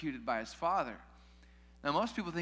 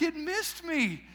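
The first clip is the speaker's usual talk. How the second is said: left-out lament; 13 semitones higher, 5 dB louder, much slower than usual. Retold or imitated. Mimics Pawson left out.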